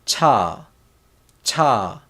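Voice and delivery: cha, crisp CH onset with falling tune; CH sound demo. Shown as a demonstration. Teacher showing the C H sound, demonstrating "cha" distinctly. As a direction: say "cha" as a model syllable, pausing between repeats. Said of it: "C H sound".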